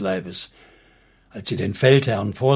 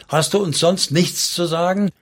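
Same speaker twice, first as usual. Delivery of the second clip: higher-pitched challenging question; interrupting; 6 semitones higher than usual. A narrator's reading. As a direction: cut in and demand more. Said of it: "unterbrachen sie ihn wieder".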